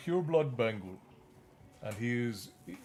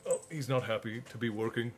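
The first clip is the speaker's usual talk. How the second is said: ordinary delivery, nothing marked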